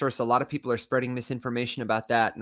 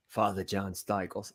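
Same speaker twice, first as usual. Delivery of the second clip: put-on lower, softer, slow New York accent; 5 semitones lower, 7 dB quieter than usual. Imitating an old Irish priest, New York accent. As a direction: drop the R in Father, New York style. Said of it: "I made him New York there".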